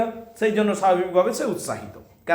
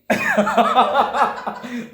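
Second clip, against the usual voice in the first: amused laugh; 5 semitones higher than usual. Real, not acted. Teacher laughs at joke.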